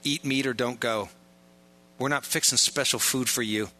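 ordinary talk

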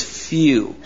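"few" drawn out slowly; stress on "few". Shown as a demonstration. Preacher underlining how few find the way, stressing "few". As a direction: lean on the word "few" and stretch it. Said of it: "I emphasize that word".

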